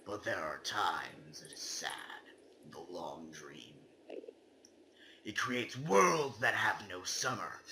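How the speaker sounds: hushed, secretive whisper; spooky whisper. Acted. Reading the game's ending poem aloud, in a whisper. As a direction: breathe it like a secret.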